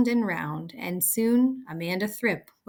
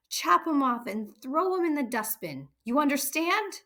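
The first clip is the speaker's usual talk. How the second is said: high, hard command; bullying headmistress; 8 semitones higher than usual. A narrator's reading. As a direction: threaten the little girl. Trunchbull orders the girl.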